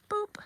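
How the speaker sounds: a squeaky high boop; mock pop sound. Showing viewers how balloon flowers pop, playful high boop.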